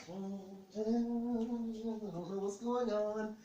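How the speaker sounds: high, drawn-out mock confusion; comic bewilderment. Husband hearing yelps from the bathroom, bewildered. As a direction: stretch the words out in a high voice.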